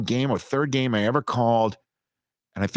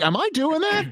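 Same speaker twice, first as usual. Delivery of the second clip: sharply higher, stunned question; retold disbelief. Asking the producer in his ear, startled disbelief. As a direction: ask it like you can't believe it's true.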